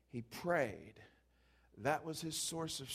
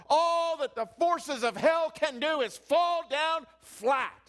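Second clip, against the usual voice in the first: preaching at full force; 9 semitones higher, 11 dB louder than usual. Acted. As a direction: lift the congregation, loud and high. Preaching builds.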